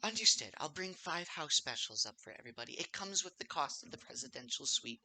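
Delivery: soft-spoken, obliging barmaid; kindly older lady. Acted. Barmaid explaining what the suite includes, kindly older lady. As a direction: gently reassure guests like a motherly server.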